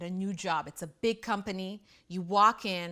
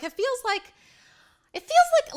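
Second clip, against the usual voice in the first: squeaky laugh rising far higher and louder; giggling; 10 dB louder than usual. Real, not acted. Mother laughing at her mommy brain joke, high and loud. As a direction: crack up mid-sentence.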